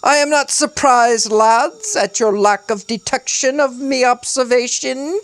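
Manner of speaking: high, pompous British rambling; self-admiring British orator, pitched high; forced British accent. A narrator's reading. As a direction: ramble on grandly in put-on British. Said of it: "His accent was forced and over the top".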